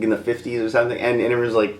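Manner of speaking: usual speaking voice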